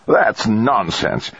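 slow spluttering outrage. A narrator's reading. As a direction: splutter it out, indignant. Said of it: "Trumbull spluttered".

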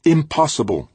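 high, loud, flat refusal; firm. A narrator's reading. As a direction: shut it down firmly. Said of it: "she said firmly".

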